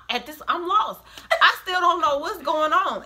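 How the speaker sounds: laughing through the words; chuckling in disbelief; giggly, can't believe it. Real, not acted. Laughing while retelling confusion.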